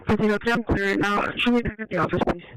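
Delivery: crackly construction walkie talkie. Real, not acted. Radio traffic heard over walkie talkie.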